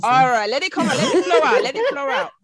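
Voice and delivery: playful coaxing. Pretend. Playfully egging on the accent.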